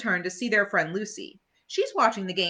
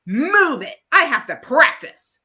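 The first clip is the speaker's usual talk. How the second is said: loud, higher demand; bossy order; 7 semitones higher, 8 dB louder than usual. Acted. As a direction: shove them off with your voice. Patty ordering older kids away.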